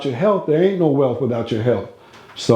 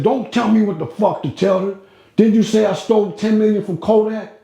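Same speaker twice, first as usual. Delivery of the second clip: mock cranky old man, much higher; cranky Niccolo; 8 semitones higher than usual. Old man cutting off Carlos, mock cranky and higher. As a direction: snap back like a touchy old boss. Niccolo snapping back, retold.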